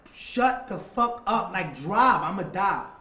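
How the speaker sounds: desperate yell. Retold or imitated. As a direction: force the driver to move, loud and urgent. Desperate command to driver.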